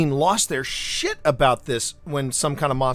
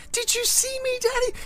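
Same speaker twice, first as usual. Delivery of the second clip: put-on much higher, softer little-girl voice; 4 dB quieter than usual. Three-year-old in a pink tutu asking her dad, in a tiny high voice. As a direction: squeak it like an excited toddler. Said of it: "And she goes".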